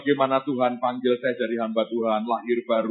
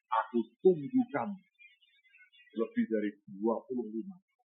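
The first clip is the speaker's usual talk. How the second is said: hushed confession; 4 semitones lower, 7 dB quieter than usual. Retold or imitated. Old man's emotional confession.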